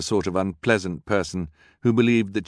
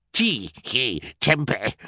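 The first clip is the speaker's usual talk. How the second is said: mock giggling nonsense words; a touch of forced villain laughter; villain cackle; 5 semitones higher, slower than usual. A narrator's reading. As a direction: cackle it out gleefully. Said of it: "Olaf cackled".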